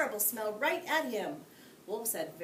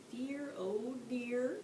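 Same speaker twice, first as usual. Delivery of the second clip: mock sympathy; 5 semitones higher, much slower than usual. A narrator's reading. Mock sympathy over fainted wolf.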